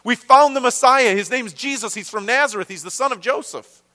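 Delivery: thrilled friend sharing big news; excited announcement. Acted. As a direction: blurt it out like you can't wait to tell him. Philip excitedly retells the news.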